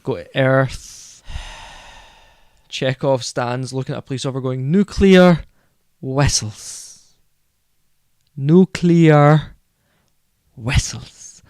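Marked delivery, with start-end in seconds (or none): 4.7-11.4 Chekhov's Russian accent, lightly imitated; a hint of a Russian accent, words drawn out. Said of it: "In his, uh, Russian accent".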